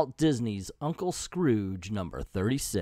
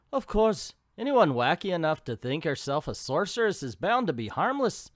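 higher-pitched, badly faked Scottish; horrible Scottish accent; 4 semitones higher than usual. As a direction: attempt a hammy Scottish accent and miss. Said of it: "That's a horrible Scottish accent".

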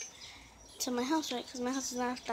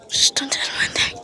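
forceful whisper, much louder; loud stage whisper; 2 semitones higher, 12 dB louder, faster than usual. Real, not acted. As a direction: hiss it urgently but loud. Hiding, shushing the viewers.

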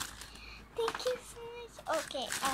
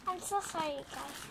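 usual speaking voice

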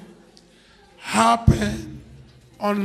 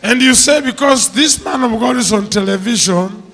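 preaching at full force; 11 dB louder than usual. Real, not acted. Preacher retells her decision loudly.

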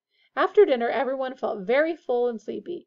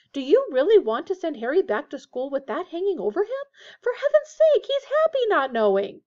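high, loud pleading climbing higher; shrill; 7 semitones higher, 6 dB louder than usual. A narrator's reading. Missus Weasley argues with Arthur to protect Harry, shrill. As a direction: protest with mounting exasperation. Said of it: "said Missus Weasley shrilly".

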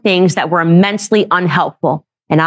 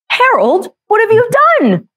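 very high-pitched cartoon impression; hammed-up high voice, scolding question; Stewie from Family Guy. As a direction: imitate an aghast brother, pitched way up. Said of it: "He sounded like Stewie from Family Guy".

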